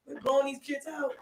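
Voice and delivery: ordinary talk, nothing marked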